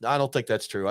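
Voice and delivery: usual speaking voice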